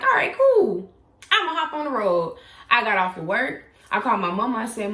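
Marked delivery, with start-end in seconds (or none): 0.0-2.4 high, loud, breezy send-off; defiant excitement. Retold or imitated. Angry at her boyfriend, a high defiant cheer about leaving. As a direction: announce it with spiteful cheer. Deciding to leave after a fight.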